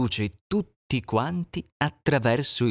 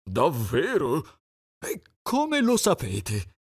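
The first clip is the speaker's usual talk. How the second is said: higher, slow doubtful pigeon; 4 semitones higher than usual. Acted. Pigeon asking the animals, higher voice. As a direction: ask like a puzzled pigeon.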